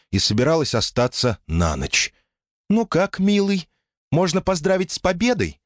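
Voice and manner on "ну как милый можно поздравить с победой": high chirpy sweet voice; cartoon princess. A narrator's reading. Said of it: "прощебетала Элоиза".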